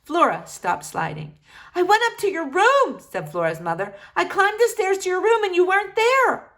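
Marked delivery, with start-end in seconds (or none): 1.7-6.5 high, louder anxious mother voice; a frightened mother pouring it out; distraught mother. Acted. Mother telling Flora she searched her room, anxious.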